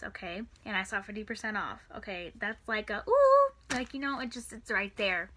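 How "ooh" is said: high, loud "ooh" of temptation; excited ooh. Retold or imitated. Shopper spotting a half-price dress, excited. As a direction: coo loudly at a bargain, pitched way up.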